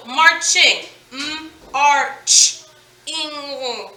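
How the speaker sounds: "M-arch" then "Ing" said as separate chunks; split into parts. Shown as a demonstration. Teacher tapping out syllables of marching for students. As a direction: break the word "marching" into root and ending. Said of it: "So march plus ing".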